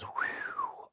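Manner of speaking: high whistled whew; soft, airy whistle of hardship; exhausted whew. Real, not acted. Exclaims at how hard running was.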